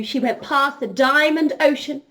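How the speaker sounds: plain reading voice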